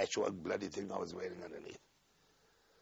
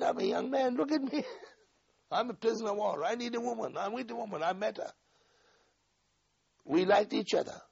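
heated plea; 12 semitones higher than usual. Retold or imitated. Pleading with interrogator, heated.

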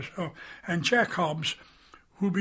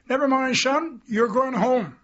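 firm order; 6 semitones higher, 5 dB louder, slower than usual. Retold or imitated. Sergeant major overrules boy.

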